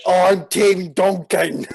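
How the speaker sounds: put-on slow, loud Scottish accent; broad Scottish accent. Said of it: "thick Scottish accent".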